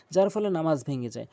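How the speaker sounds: ordinary talk, nothing marked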